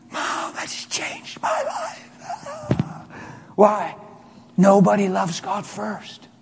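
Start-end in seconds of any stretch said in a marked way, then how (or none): 0.1-2.1 very high, whispery sob; acted weeping, slow and barely voiced; imitated crying. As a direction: squeeze the words out through tears. Son tearfully telling his mother.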